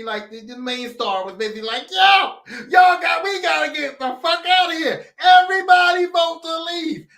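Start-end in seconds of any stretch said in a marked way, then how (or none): 1.9-5.1 high, loud, slow shouted plea; rally the room to get out; urgent rallying shout. Retold or imitated. Main star urging everyone out. 5.2-7.1 high, loud shout of Everybody vote to leave; shouted plea. Retold or imitated. First rallying call.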